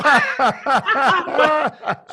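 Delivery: a big, high whooping laugh; loud burst of laughter. Real, not acted. Cracking up while retelling a friend's prank.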